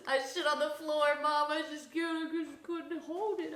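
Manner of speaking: a laughing, whiny guilty dog voice; a hammed-up apologetic dog voice with laughter; sorry dog, laughing. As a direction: beg Mom's pardon like a guilty puppy, half laughing. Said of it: "They're like".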